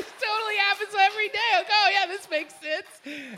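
high, giddy mock acceptance; laughing sarcasm. Joking that the absurd pool scene is normal, laughing sarcasm.

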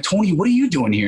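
retold surprised exclamation; surprised greeting. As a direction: act out bumping into a childhood pal. Running into childhood friend at audition.

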